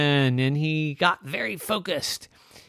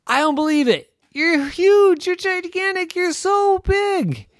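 very high, loud awestruck exclamations; amazed; 7 dB louder than usual. A narrator's reading. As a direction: marvel at his size. Clouds amazed.

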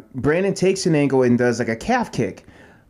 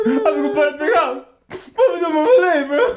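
mock crying; 13 semitones higher than usual. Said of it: "I coulda had a Boobie Miles moment".

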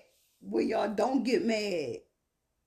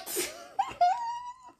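high-pitched acted giggle; high mischievous laugh, acted out; mock giggling. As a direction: giggle high, as if gloating over a reaction. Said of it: "we be like".

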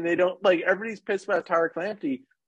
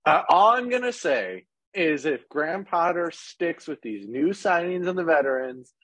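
ordinary talk, nothing marked

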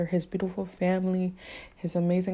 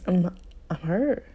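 hurt; slower than usual. Real, not acted. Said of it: "I'm sorry I'm getting emotional".

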